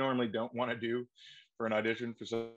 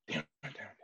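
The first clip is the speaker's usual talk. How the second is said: much softer repeated damn it; muttered regret; 2 semitones lower, 8 dB quieter, much faster than usual. Retold or imitated. Actor alone after rejection, soft muttered regret. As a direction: curse yourself under your breath.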